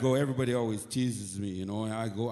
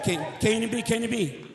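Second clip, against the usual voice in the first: calling the station; 8 semitones higher, 4 dB louder, faster than usual. Retold or imitated. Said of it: "I said".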